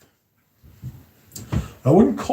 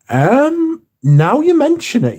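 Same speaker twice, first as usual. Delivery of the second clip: high, rising surprise; suddenly struck by the idea; surprised; 14 semitones higher, 3 dB louder, slower than usual. Real, not acted. Sudden realisation about the scent.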